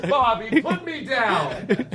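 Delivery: high, drawn-out laughing mimicry; playful mock protest. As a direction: stretch it out high, as if being carried around. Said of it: "that's how much fun you're having".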